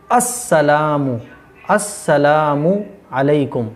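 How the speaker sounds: slow "अस्सलामु अलैकुम", two separate words; correct salam. Shown as a demonstration. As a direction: hold the "ला" and sound the final "मु" in "अस्सलामु". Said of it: "नहीं करना है". ("अस्सलामु अलैकुम" ठहरकर, साफ़)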